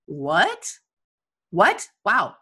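disbelieving what, then a wow; short rising whats of disbelief; mock astonishment. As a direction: react as if stunned by your own belief. Mock astonishment at own belief.

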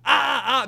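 high loud pained ahs; mock crowd wail. Everyone on the highway remembering the ending, loud mock wail. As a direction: howl like the whole highway is hurting. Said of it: "people going".

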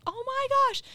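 delighted gasp. Retold or imitated. Quilter hearing shop needs samples quilted, high delighted squeal. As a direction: squeal at the lucky break. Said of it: "So I'm like".

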